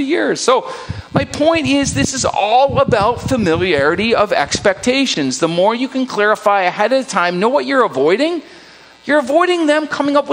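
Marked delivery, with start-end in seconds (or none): none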